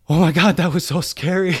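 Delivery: high, very loud mock panic; Hank, terrified. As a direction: blurt it out in hammed-up fright. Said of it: "he's just so, like, terrified".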